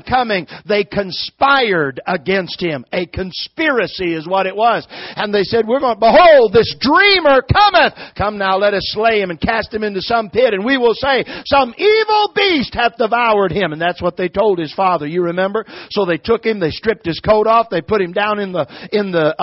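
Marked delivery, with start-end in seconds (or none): 5.7-13.7 declaimed scripture. Acted. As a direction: proclaim the plot like a herald. Declaims the brothers' words from scripture.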